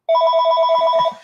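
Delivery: sudden high, loud burst of laughter. Real, not acted. Big laugh at own story.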